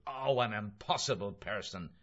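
mildly exasperated outburst; understated indignant exclamation; exclaiming. A narrator's reading. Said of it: "he exclaimed".

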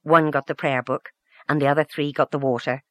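plain reading voice

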